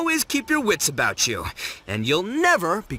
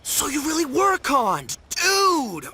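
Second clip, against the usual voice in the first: high, astonished exclamation; amazed; 9 semitones higher, slower than usual. Acted. To a friend who confessed being duped, amazed. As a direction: pitch it up in amazed realization.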